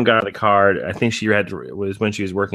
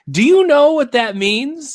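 high, slow, louder questioning; mock stern mom; 5 dB louder, slower than usual. Mom to young son, slow high questioning. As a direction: draw the question out like a mom checking. Mom asking kid, retold.

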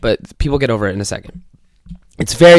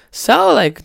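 mock chipper coworker; 11 semitones higher than usual. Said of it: "He's so happy".

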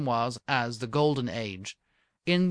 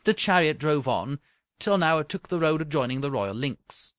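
plain reading voice

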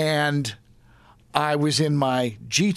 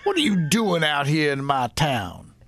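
put-on Arizona cop accent; 5 semitones higher than usual. Cop confronting out-of-town speeder, Arizona accent. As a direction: question the speeder in an Arizona cop's twang. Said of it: "this Arizona accent".

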